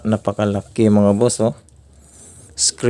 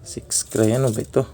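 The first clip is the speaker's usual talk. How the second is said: ordinary talk, nothing marked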